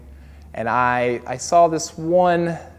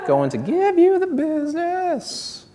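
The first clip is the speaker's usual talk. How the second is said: much higher, slow sing-song take of the line; high falsetto repeat. Pretend. Repeating his threat to the old lady in a high slow voice.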